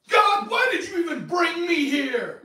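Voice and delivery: hammed-up loud high shout at God, laughing; mock angry yell. Pastor imitating a contestant yelling at God, mock rage. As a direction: imitate the contestant raging at heaven. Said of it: "that he gets mad".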